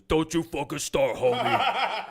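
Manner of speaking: loud, high retold tough-guy warning; mock threat. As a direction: bark it like a bar bully, half laughing. Retold bar confrontation with a heckler.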